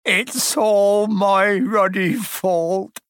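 loud-ish, high, drawn-out tearful wail; sobbing. A narrator's reading. Hagrid to Harry, blaming himself, sobbing. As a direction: wail it slowly through acted sobs. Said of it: "he sobbed".

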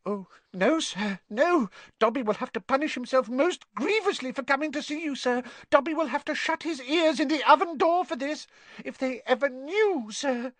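high, hurried squeaky elf, fearful; anxious house elf. Acted. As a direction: fret in a high little voice.

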